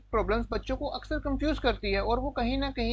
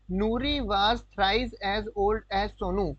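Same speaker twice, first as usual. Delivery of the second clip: slow, word-by-word reading of the question; slow dictation; much slower than usual. Shown as a demonstration. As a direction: read it out slowly for students to copy. Rereads the condition slowly. (धीरे-धीरे शब्द-शब्द पढ़ना)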